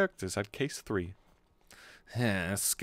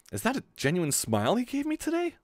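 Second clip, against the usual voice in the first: surprised; 10 semitones higher, 4 dB quieter than usual. Acted. Voicing Shinji's surprised thought.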